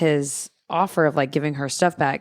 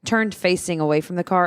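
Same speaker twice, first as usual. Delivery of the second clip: ordinary delivery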